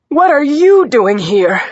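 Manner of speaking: high, loud, drawn-out challenge; agitated demand. A narrator's reading. Injured woman to unwelcome detective, agitated demand. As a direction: demand it loudly, stretching the words.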